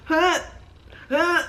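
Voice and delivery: sharp, high mock gasps; imitated gasps. Woman in labour seconds apart, imitated gasping. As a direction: imitate breath caught by back-to-back contractions. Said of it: "I couldn't breathe".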